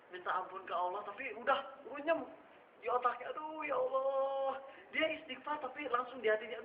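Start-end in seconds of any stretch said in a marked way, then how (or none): 3.3-4.6 slow, drawn-out regretful groan to God; anguished inner sigh. Acted. Young man sighing to God in regret, slow and anguished. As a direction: sigh it out slowly, torn with regret. Pemuda sighs inwardly after sinning.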